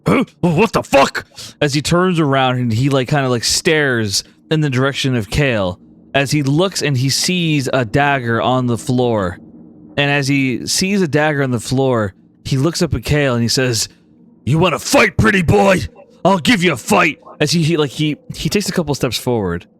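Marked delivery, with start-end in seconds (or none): 0.1-1.2 higher-pitched startled swearing; startled outburst. Acted. Tyke startled by a dagger whiffing past, startled outburst. 14.4-17.2 higher, aggressive taunt rising to a shout; angry challenge. Acted. Tyke challenging Kale over the dagger, angry taunt.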